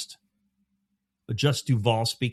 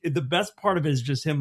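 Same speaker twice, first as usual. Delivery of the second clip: ordinary talk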